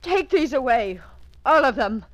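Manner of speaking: loud, sharp command; angry order. Acted. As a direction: sweep it all away in disgust. Ordering creams removed.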